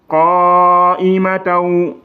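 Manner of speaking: very slow chant, qa'imataw ending on a waw; Quranic recitation. Shown as a demonstration. Teacher chants the merged word for students. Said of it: "ça donne taw".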